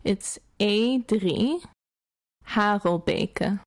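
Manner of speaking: "E drie Harelbeke" with Dutch "drie"; slow, careful Dutch "E drie Harelbeke", "beke" as bay-kuh; Dutch pronunciation. Shown as a demonstration. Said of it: "if I were to pronounce it in Dutch".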